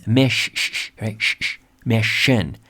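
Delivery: "mish, shh", isolating the sh before saying "mission"; exaggerated sh in mission. Shown as a demonstration. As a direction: break "mission" at a long shushing sh. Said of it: "como shh".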